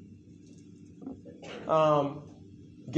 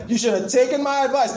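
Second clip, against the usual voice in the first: mock I-told-you-so; 7 semitones higher, 4 dB louder than usual. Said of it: "He's like".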